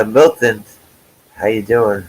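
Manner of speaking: ordinary delivery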